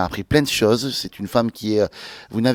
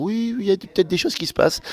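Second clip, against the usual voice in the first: high mock quote; 9 semitones higher than usual. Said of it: "on commence à dire".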